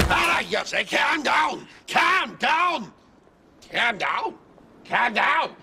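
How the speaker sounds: high, shouted calm down; Scouse man shouting to calm down. Acted. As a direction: yell it repeatedly. Calm down catchphrase.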